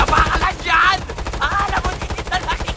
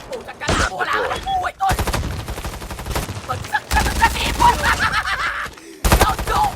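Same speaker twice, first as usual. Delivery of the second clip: gleeful taunting. Real, not acted. Player gloating at downed enemies, high rising gleeful taunt. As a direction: pitch climbing higher and higher into a gloating yell.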